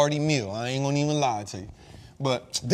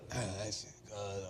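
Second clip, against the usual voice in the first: low, hushed groan of satisfaction then a breathy laugh; contented sigh; 8 semitones lower, 14 dB quieter than usual. Retold or imitated. Going home full from dinner, a hushed satisfied groan. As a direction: sink back stuffed and breathe it out. Full after the meal, relaxed.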